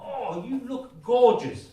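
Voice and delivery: drawn-out doting praise; gushing compliment. Acted. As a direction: lay the compliment on thick, slowly. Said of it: "I'm supposed to say something like".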